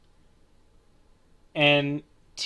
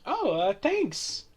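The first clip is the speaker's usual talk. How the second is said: lady voice; 9 semitones higher, 3 dB quieter, slower than usual. Acted. GM voices the dog owner.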